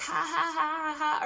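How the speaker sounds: high mock laugh, spoken out; imitated laughing. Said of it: "like, laughing and all".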